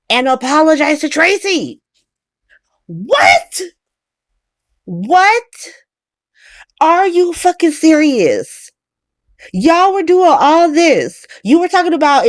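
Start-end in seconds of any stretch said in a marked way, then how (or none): 2.9-5.4 high, rising double what; shocked at the family's apology, rising cries; stunned disbelief. Real, not acted. 6.8-12.3 exasperated rant. Real, not acted. Ranting at the family.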